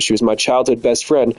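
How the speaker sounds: ordinary delivery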